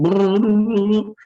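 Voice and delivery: high-pitched rolling brrr; drawn-out imitation of a rising hubbub; imitated crowd rumble. Preacher mimics the noise reaching the blind man, rolled rumble.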